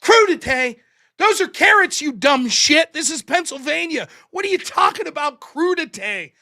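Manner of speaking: loud, incredulous comeback; mock outrage. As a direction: throw "crudité" back in loud disbelief.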